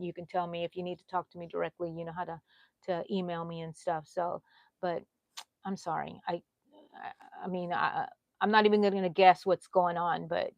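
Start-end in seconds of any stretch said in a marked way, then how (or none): none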